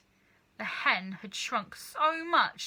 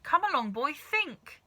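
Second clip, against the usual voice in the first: high, louder coaxing; coaxing father; 6 semitones higher, 4 dB louder than usual. A narrator's reading. Mister Cranky pressing George to remember, high urging. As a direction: push the boy to think.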